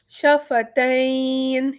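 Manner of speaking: شفتین slow, with the leen vowel stretched; madd leen. Shown as a demonstration. Tajweed teacher demonstrates madd leen. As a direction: draw out the ay in شفتین. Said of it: "یہ مد لین ہو گیا".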